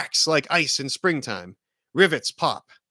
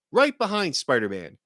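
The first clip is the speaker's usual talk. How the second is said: plain reading voice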